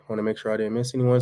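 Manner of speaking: ordinary talk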